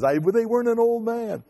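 high-pitched mock retort; wife answering her husband's teasing, in a high imitation; imitating wife's retort. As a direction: snap back in a high wife voice. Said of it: "obviously she says".

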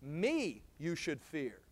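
slow, with Me set first and weighted; stress on me. Shown as a demonstration. Preacher showing the Hebrew word order, slow weight on Me. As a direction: lean hard on the first word Me. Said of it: "the word me is emphatic".

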